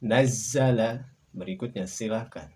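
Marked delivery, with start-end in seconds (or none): 0.0-1.1 Nazzala very slow, zz held; slow shadda demo. Shown as a demonstration. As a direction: stretch the zz in Nazzala. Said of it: "contoh pelafalan kami".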